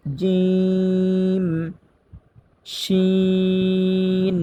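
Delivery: জিম and শিন each drawn out long from mid-tongue; letter demo. Shown as a demonstration. Teacher demonstrating sixth-makhraj letters to students, drawn out. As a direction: stretch জিম, শিন slowly. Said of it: "তিনটি হরফ উচ্চারিত হয়".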